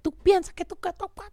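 high-pitched imitation of Spanish-speaking nagging; mock scolding girlfriend. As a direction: mimic her telling you off in Spanish. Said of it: "they be like".

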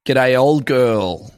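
put-on slow, drawn-out Australian "G'day, old girl."; Aussie greeting. Showing the Aussie way to greet mum, slow Australian drawl. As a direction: lay the Australian accent on thick and slow. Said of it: "the Aussie way to say".

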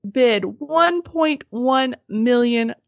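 very slow, weighty delivery; dramatic reveal. Real, not acted. Storyteller revealing the winning bid to co-hosts, slow and dramatic. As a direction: space out each word for the big reveal.

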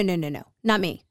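usual speaking voice

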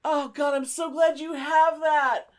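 ordinary delivery

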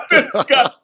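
high-pitched helpless laugh into words; real laughter. Real, not acted. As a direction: crack up fondly at your own story. Cracks up at his own story.

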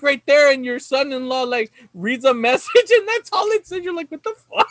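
real laughter; laughing embarrassment. Real, not acted. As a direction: crack up while telling it. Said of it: "I felt so stupid".

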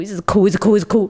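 normal talking voice

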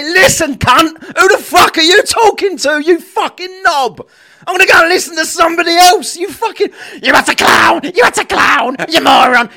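loud, high-pitched shouted tirade; angry telling-off. Acted. Showing listeners how to answer the bully back, angry shouting. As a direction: bellow the insults as a fed-up challenger would. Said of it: "One of you people to say".

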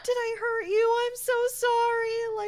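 put-on a small girl fretting that she hurt someone; little girl voice. Four-year-old Mimi apologizes to Amelia in a high, distressed little-girl voice.

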